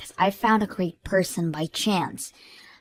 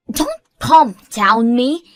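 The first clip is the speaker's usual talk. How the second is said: slow, louder angry retort; indignant shout; 3 semitones higher, 9 dB louder, slower than usual. Acted. Sherry to Patricia, slow loud indignant retort. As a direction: bark it back slowly at full volume.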